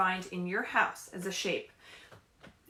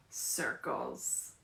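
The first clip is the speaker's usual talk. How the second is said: lower, soft whispered answer; 9 semitones lower, 9 dB quieter than usual. Real, not acted. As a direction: breathe the answer as a secret. Answers her own question softly.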